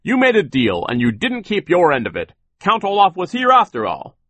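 high, forceful retort; loud insistence. A narrator's reading. Sir holds them to the deal, loud and high-pitched. Sir answers Poe's stern challenge.

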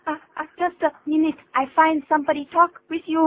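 put-on loud, high, flustered Chinese-accented English; Chinese learner's English. Chinese learner caught out by an English phone call, flustered Chinese accent. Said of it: "把我们中国人在学英文的一些毛病".

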